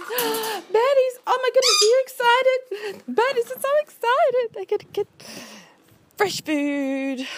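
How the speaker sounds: high, loud gushing pet voice. Real, not acted. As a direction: gush over the birds like babies. Talking to excited birds.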